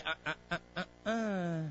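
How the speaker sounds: soft little warning noises; hushed, repeated tut of caution; warning inner voice. Acted. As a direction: lightly voice a conscience saying no. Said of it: "hear that voice inside say".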